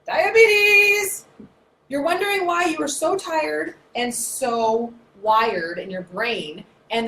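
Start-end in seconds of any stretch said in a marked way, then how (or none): 0.1-1.2 high loud announcement; loud high cry; dramatic exclamation. Acted. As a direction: hit it like a verdict. Punchline exclamation.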